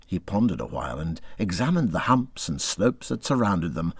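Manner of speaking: plain reading voice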